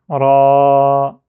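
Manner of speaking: loud, long held "Ra"; voyelle prolongée. Shown as a demonstration. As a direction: stretch the vowel of "Ra" out, loudly. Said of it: "je prolonge". (« Ra » avec un a longuement prolongé)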